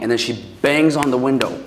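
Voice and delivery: normal talking voice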